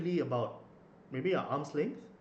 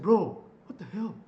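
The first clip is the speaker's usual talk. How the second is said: retold outraged retort; 7 semitones higher, 9 dB louder than usual. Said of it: "I said".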